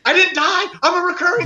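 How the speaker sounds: high, thrilled disbelief; retold elation. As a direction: re-enact giddy relief at great news. Retells relief at surviving the script.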